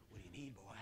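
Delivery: very low, hushed tough-guy impression; Clint. Rock yard worker greets customer, very low, soft Clint impression. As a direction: drop it way down and soft. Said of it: "It looked like Clint".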